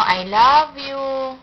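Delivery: very slow, drawn-out prompt to copy; slow modelling. Shown as a demonstration. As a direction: stretch out LOVE for a child to repeat. Models words for child to repeat. (mabagal at pahabang pagbigkas)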